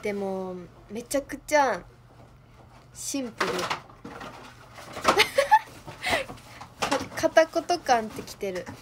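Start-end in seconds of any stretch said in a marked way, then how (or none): 3.6-7.1 breathy laugh. Real, not acted. Laughing at own wooden reading.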